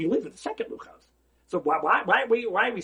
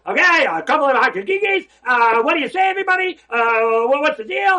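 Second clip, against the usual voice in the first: mock hearty call; 8 semitones higher, 9 dB louder than usual. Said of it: "Each one should have said".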